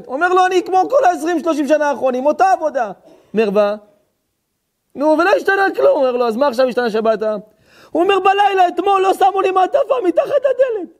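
high, loud, agitated dramatic telling; impassioned storytelling. Acted. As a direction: cry out the tale at full force. (סיפור נרגש בקול רם וגבוה)

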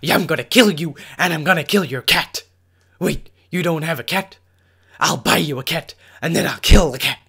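low, soft villain voice; mock gunman threat. Imagined gunman orders the streamer to dab, mock threatening. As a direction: drop way down and threaten with comic menace. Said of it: "and they're like".

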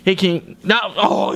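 ordinary talk

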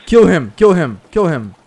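commanding shout; urgent shout. Real, not acted. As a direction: yell at your teammate to fire. Urging teammate to shoot.

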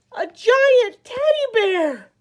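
high, loud, drawn-out exclamation; amazed. A narrator's reading. Eddie finds a giant teddy, amazed. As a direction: announce it slowly in big amazement.

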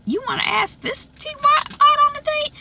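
a shrieking, pointing "this one?"; incredulous high squeal. Retold or imitated. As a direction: shoot way up high in mock disbelief. Repeats sister's incredulous line.

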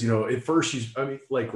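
ordinary talk, nothing marked

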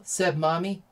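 ordinary delivery, nothing marked

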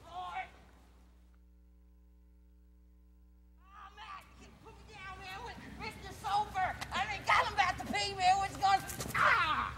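high, soft frightened babbling; panicky whining. Acted. Carried off by Mister Sober, whining in fear. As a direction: whimper and plead, scared out of your wits.

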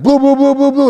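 high loud repeated call of blue; yelling. Retold or imitated. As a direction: holler it to get them moving. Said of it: "yelling at the guys just like that".

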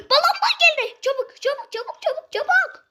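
high, frantic urging; terrified high-pitched hurry; panicked rush. Real, not acted. Panic as Balloon Boy arrives.

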